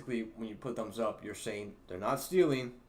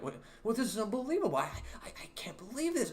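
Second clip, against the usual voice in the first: much higher, mock indignant disbelief; outraged customer; 11 semitones higher than usual. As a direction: imitate a shocked customer losing it over coupons. Customer outraged at coupon refusal.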